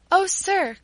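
high, loud urgent call; crying out. A narrator's reading. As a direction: call out for the clerk's attention. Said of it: "cried Paula to one".

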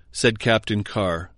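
ordinary delivery

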